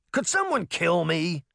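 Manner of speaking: put-on high, whiny boy's plea; begging. A narrator's reading. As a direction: groan it like a disgusted kid. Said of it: "Puck begged".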